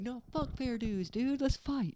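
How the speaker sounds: mock brash American; mock tough American. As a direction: pick a fight like a bro. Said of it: "in America, we'd be like".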